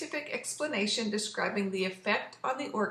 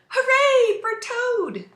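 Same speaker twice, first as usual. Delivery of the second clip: very high, loud, slow cheer; shouted cheer; 12 dB louder, slower than usual. Acted. Frog cheers Toad from the theater, loud high shout. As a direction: cheer him on at full voice. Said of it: "he shouted".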